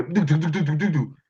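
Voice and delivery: quick "do do do" punches; rapid punch sounds. Retold or imitated. Showing how he landed a flurry, rapid punch noises. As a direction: rattle off mock punch noises.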